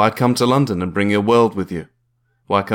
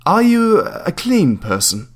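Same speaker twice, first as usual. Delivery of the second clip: higher, slow, probing landlady voice; prim landlady; 9 semitones higher, slower than usual. Acted. Landlady quizzing a would-be tenant, higher and slow. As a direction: ask it slowly, sizing him up.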